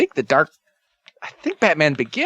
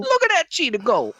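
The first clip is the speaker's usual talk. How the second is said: high silly voice. Pretend. Silly voiced movie line.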